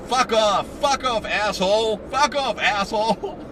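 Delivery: losing it, loud and very high; imitated furious screaming. Shopper losing her mind at a customer, imitated screaming. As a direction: mimic her out-of-control angry yelling. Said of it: "she lost her mind".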